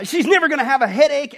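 laughing through the punchline. Real, not acted. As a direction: pastor losing it mid-joke while voicing God. Joke, laughing while quoting God.

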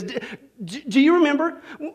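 stammering husband, the words not coming easy; halting stammer. Retold or imitated. Husband hesitating to his wife, halting and stammering. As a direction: stumble over the start, d-, d-, do you. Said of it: "he pauses. The words, they're not coming easy".

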